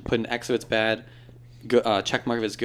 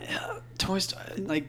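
hushed whisper; 8 semitones higher, 10 dB quieter, slower than usual. Real, not acted. Excited hushed reaction.

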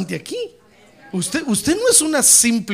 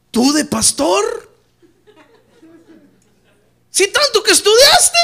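high, loud incredulous cry; old friends scoffing, in loud disbelief; mocking disbelief; 13 semitones higher, 10 dB louder, slower than usual. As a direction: laugh it out in disbelief. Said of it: "me dicen".

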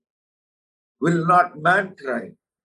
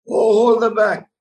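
pleading cry, higher and louder; God crying out; 7 semitones higher, 6 dB louder than usual. Acted. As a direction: call out as God begging you to hold them back. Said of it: "This is God crying out to you".

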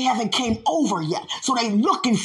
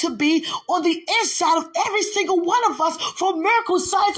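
preaching; 7 semitones higher than usual. Acted. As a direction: press the point home to the congregation. Preacher exhorting congregation.

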